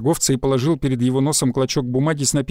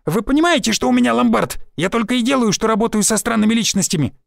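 desperate outcry; 7 semitones higher than usual. A narrator's reading. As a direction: yell it in pain and panic. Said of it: "выкрикнул Шульман". (отчаянный выкрик, намного выше)

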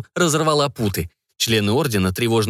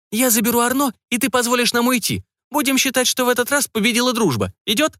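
witch bargaining; 13 semitones higher than usual. Acted.

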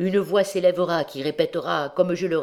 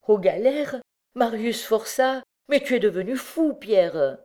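high-pitched maternal protest, urgent and incredulous; indignant outcry; 6 semitones higher than usual. A narrator's reading. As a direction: protest passionately in a raised pitch. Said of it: "s'écria Miette". (protestation indignée et bouleversée)

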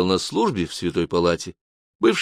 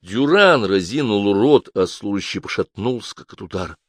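ordinary delivery, nothing marked

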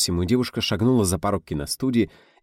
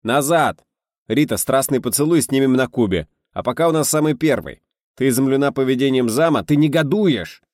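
louder and higher all through, insistent; loud directing; 7 semitones higher, 6 dB louder than usual. A narrator's reading. Director explaining the scene to Rita, loud and insistent. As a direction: drive the actress to redo it.

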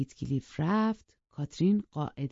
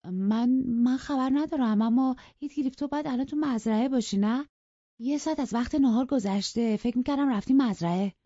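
put-on flustered girl stammering an excuse; 8 semitones higher, 3 dB louder than usual. A narrator's reading. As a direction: stumble over the words, nervous. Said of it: "با من و من گفت".

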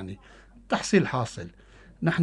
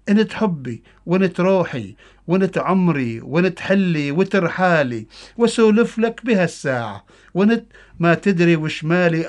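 loud, higher Bedouin dialect poem recitation; Bedouin Arabic accent; 4 semitones higher, 7 dB louder than usual. Acted. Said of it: "قصيدة باللهجة البدوية".

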